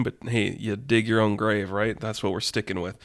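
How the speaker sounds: normal talking voice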